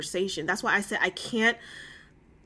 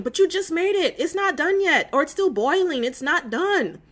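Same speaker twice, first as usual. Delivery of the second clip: higher, louder mock mom protesting; mock parent, higher and louder, protesting; exasperated parent; 7 semitones higher, 3 dB louder than usual. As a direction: play the harried mom fending off a kid. Parent answering impatient child.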